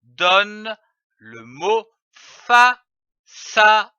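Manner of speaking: "façade" drawn out slowly, syllable by syllable; slow teaching voice. Shown as a demonstration. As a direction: stretch out "façade" for young readers. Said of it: "L'association de ces trois syllabes".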